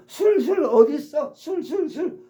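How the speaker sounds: high, loud, desperate begging for booze; craving drunk. Retold or imitated. Teacher imitating an alcoholic begging for drink, craving. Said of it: "술 중독 그런 사람들은".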